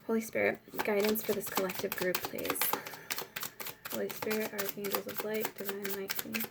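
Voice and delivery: soft, hushed murmured prayer to the Holy Spirit; quiet prayer. Real, not acted. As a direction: pray under your breath, calling on the angels. Prays quietly over the cards.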